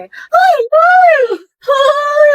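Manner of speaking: high, loud squealing "ay"; flustered girl shrieks at her crush; excited shrieks. Acted. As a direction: shriek like a giddy fan.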